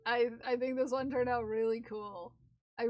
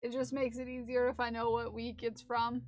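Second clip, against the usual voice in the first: ordinary delivery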